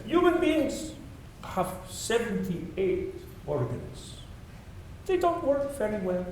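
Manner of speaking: self-mocking, high-pitched imitation of a French accent; Francis Allais, bad French accent. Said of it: "you'll forgive my bad French accent".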